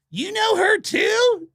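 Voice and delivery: high, stretched-out shout; mock astonished squeal. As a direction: imitate a rocker shrieking in amazement. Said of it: "and [name] goes".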